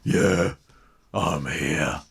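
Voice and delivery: low grumpy grunt; surly kitten. A narrator's reading. Tiddles grudgingly answering, low and surly. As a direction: mumble like a bored bully.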